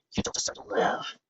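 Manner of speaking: whispered death threat; menacing whisper. A narrator's reading. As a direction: hiss the verdict under your breath. Threat with blade raised.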